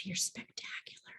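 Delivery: hushed loving whisper; softly breathed praise. Shown as a demonstration. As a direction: whisper it to your work.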